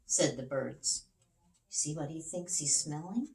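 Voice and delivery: usual speaking voice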